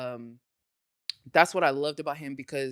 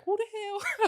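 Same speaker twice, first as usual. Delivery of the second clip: high-pitched mock indignant question; taken aback, half laughing; who-do-you-think-you-are, played for laughs; indignant retort; slower than usual. Retold indignant reaction, laughing.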